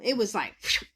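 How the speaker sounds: normal talking voice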